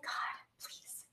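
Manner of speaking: hushed, half-whispered pleading to God; retold whispered prayer. Sobbing in her car, a whispered prayer to God. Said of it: "and I'm sobbing".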